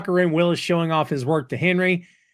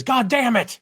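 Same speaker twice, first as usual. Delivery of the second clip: higher, mock-frustrated curse; frustrated outburst; 10 semitones higher than usual. Podcaster voicing Roy banging his desk, mock frustrated. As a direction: blurt it out like a fed-up grump. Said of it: "Roy bangs on his desk and says".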